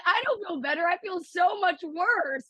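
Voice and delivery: high-pitched, wailing retold complaint; upset protest. Daughter rejecting mom's comfort after losing, upset protest.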